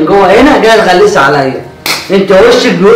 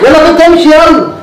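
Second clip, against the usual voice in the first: higher, brushing her off; irritated shooing; 8 semitones higher than usual. Acted. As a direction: push her out the door. Brother shooing sister away. (أحمد بيطرد أخته بعصبية)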